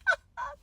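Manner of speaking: short high laugh; high giggle. Real, not acted. Shopper giggles at her luck, high. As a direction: giggle in delight.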